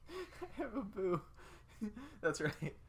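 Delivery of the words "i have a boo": ordinary talk, nothing marked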